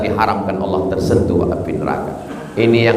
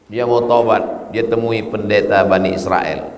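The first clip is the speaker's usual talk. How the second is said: ordinary delivery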